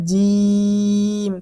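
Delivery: জিম with a long held ee; three-alif stretch. Shown as a demonstration. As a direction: hold the vowel of জিম for three alifs, steady pitch. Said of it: "তিন আলিফ টান হবে". (জিম তিন আলিফ টেনে)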